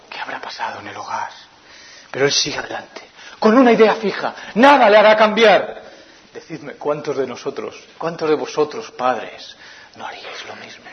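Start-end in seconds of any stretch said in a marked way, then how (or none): 0.1-1.3 whispered anxious question; dramatic whisper. Acted. Pregunta dramática susurrada del predicador. 10.0-10.8 unvoiced rhetorical question; whispered appeal. Pregunta retórica a la congregación.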